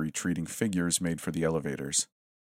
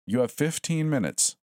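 high, drawling announcer voice; 9 semitones higher than usual. Acted. Intercom voice drawling a warning to students. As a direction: stretch it out, taunting. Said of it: "the voice drawled".

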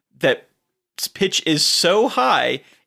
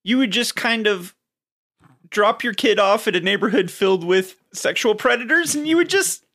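put-on old-timer voice; 7 semitones higher than usual. Mock back-in-my-day old-timer.

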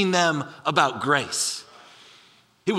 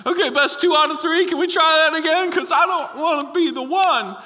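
higher, pleading disciple begging for a rematch; 7 semitones higher, 3 dB louder than usual. Retold or imitated. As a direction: beg not to be the one who has to go. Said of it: "You know, like".

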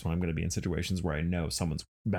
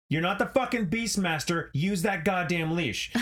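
retold angry yell; 10 semitones higher than usual. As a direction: bark it at him like you mean it. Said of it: "and I was like".

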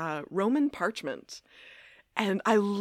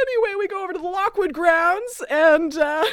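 much higher and louder through laughter; real laugh-through; laughing loudly; 9 semitones higher, 6 dB louder than usual. Real, not acted. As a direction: push on while cracking up. Laugh-shouting transition.

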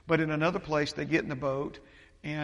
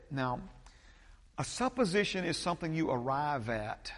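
ordinary talk

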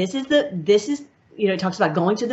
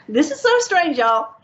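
high-pitched, amused disbelief with a laugh; laugh, then let the pitch leap up; 8 semitones higher, 3 dB louder than usual. Real, not acted. Speaker remarks to audience on strange passage, laughing.

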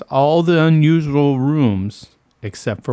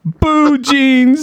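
high, loud punchline; punchline delivery; 12 semitones higher, 5 dB louder than usual. Acted. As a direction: sell the pun big. Punchline of riddle.

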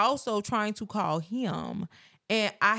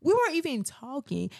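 indignant outburst; 12 semitones higher than usual. Real, not acted. Arguing with partner about pregnancy.

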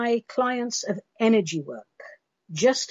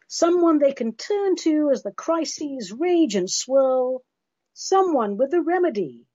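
lively, sing-song poem reading; poem recital; 5 semitones higher than usual. Acted. Reading a funny poem to her viewers, lively recital. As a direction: recite it with comic rhythm. Reads Pam Ayres poem aloud.

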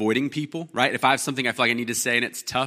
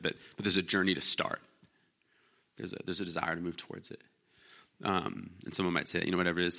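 ordinary talk

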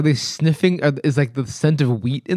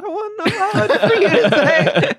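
high mock question. Imitating friend's question.

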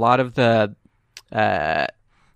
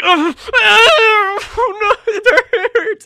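loud, very high hammed-up wail; over-the-top pained sobbing; crying in pain; 8 dB louder, much slower than usual. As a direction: bawl like a tough guy falling apart. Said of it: "like, crying".

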